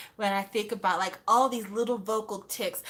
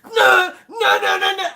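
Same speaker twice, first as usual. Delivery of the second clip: louder, higher pleading no's; loud mock wail; 7 semitones higher, 10 dB louder than usual. Fan imitating singer with a loud wailing no. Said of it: "He's like".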